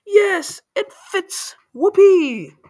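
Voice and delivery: high, loud squeal of triumph; gleeful scream. A narrator's reading. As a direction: scream it out, whooping with delight. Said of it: "But she screamed".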